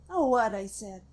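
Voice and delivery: usual speaking voice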